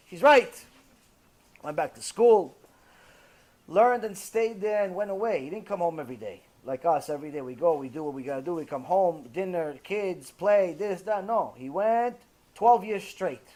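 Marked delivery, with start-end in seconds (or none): none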